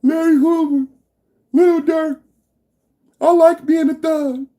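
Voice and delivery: high, hammed-up tearful wail; mock crying. Host imitates crying Trump haters missing their crack, mock sobbing. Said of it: "They gonna be crying".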